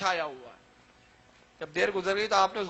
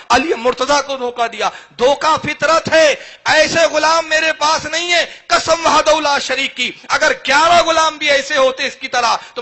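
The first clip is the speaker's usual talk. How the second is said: fiery preaching; 4 semitones higher, 9 dB louder than usual. Acted. Preacher rousing the audience at the story's climax, full force. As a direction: proclaim each line to the crowd.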